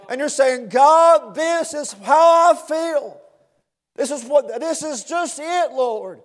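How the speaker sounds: preacher building to a cry before the congregation; preaching at full force. Acted. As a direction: build the plea with fervor, voice lifted. Preaching, pouring out complaint.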